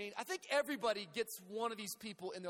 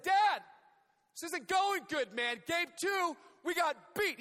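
put-on higher, louder boyish complaint; frustrated son yelling; 8 semitones higher, 6 dB louder, slower than usual. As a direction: son yelling at dad after a loss. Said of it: "I came, I said".

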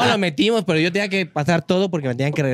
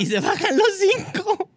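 much higher and slower, building suspense; dramatic reveal; slower than usual. Retold or imitated. Telling friends five guys jumped out, high and slow for drama. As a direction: stretch it out for drama. Dramatic turn in the fight story.

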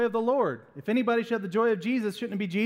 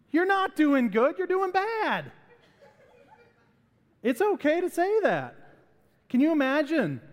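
usual speaking voice

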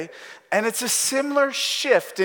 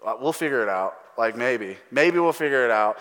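lightly acted couldn't-care-less shrug; low, noncommittal, put-on unconcern; mock indifference; 5 semitones lower than usual. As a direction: brush it off as if it hardly matters. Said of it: "he does not care at all".

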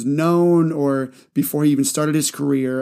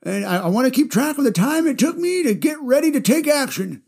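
put-on high, wobbly old man; grandpa voice; 9 semitones higher than usual. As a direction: play a rambling old grandpa. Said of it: "I just love doing my grandpa voice".

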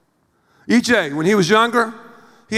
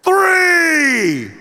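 loud, much higher, near-crying shout; theatrical counting shout; 8 semitones higher, 4 dB louder than usual. Retold or imitated. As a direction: wail the last count in exasperation. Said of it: "And I get louder and louder in theatrics".

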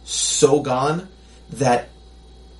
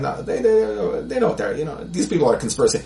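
mock naive believer; 6 semitones higher than usual. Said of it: "we're like".

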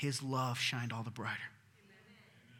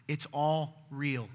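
"all" drawn out, slow; slow emphatic insistence; stress on ALL; much slower than usual. Real, not acted. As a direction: lean on "all" slowly. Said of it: "repeated slow emphatic".

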